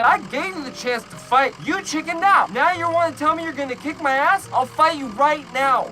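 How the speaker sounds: heated challenge. Retold or imitated. Storyteller to the driver who chickened out, heated challenge. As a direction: re-enact daring him to fight.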